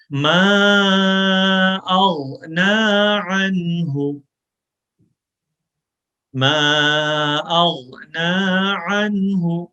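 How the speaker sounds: slow, drawn-out melodic chant, ما held long; slow Quran recitation. Shown as a demonstration. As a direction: stretch the madd on ما. Recitation with madd.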